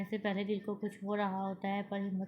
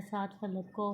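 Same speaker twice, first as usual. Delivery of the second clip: usual speaking voice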